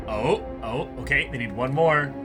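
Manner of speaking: ordinary talk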